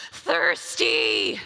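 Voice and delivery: deep, drawn-out croak like crawling through a desert; dying-of-thirst croak.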